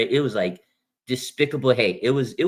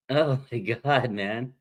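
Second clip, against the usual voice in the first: normal talking voice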